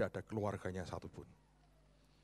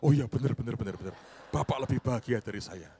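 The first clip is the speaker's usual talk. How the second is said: much louder, eager yes-yes-yes; emphatic agreement; 10 dB louder than usual. Retold or imitated.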